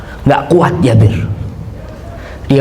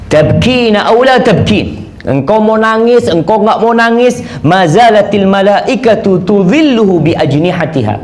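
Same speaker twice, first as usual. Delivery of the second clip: Prophet's question; 8 semitones higher than usual. Acted. Prophet speaks to grieving aunt.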